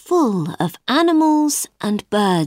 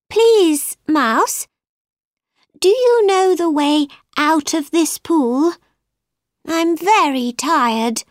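higher-pitched, courteous Alice asking for help; polite pleading; 7 semitones higher than usual. A narrator's reading. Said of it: "Alice asked politely".

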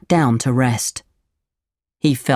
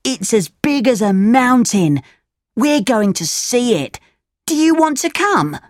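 put-on higher-pitched boy voice; eager tall-tale boy, pitched up; schoolboy, luring; 8 semitones higher than usual. A narrator's reading. Boy invites Pinocchio to see a shark, higher luring voice.

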